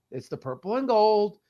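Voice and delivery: usual speaking voice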